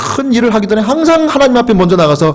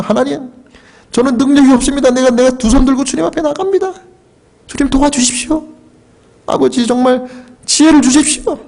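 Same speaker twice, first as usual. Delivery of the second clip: higher, imploring prayer voice; fervent prayer; 7 semitones higher than usual. Shown as a demonstration. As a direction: cry out to God on your knees. Demonstrates his own kneeling prayer.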